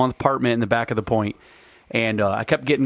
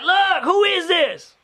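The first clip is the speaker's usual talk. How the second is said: slow, high-pitched caller voice; imitated irate caller; slower than usual. Imitating the repeated wrong-number caller, high exasperated voice. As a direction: demand it slowly in a high caller voice.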